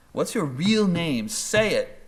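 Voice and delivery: high, slow, emphatic demand; dramatised insistence, pitch leaping up on each stressed word; insistent demand. Retold or imitated. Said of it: "they insist".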